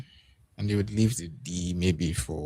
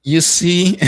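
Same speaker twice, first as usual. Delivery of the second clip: excited; 11 semitones higher, 12 dB louder than usual. Real, not acted. Commentators react to big play.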